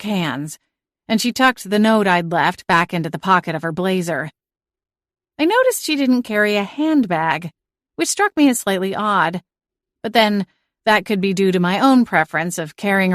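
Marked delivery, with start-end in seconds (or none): none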